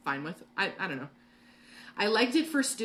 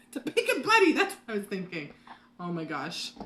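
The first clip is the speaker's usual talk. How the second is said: laughing; 3 dB louder than usual. Real, not acted. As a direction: laugh it out, relieved and amused. Laughs at finally remembering name.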